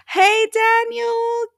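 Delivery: high, loud, drawn-out hello; excited greeting. Real, not acted. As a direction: call out in delight to a friend who just arrived. Excitedly greets viewer joining the stream.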